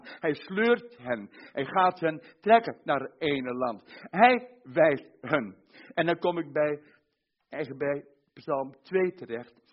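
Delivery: ordinary talk, nothing marked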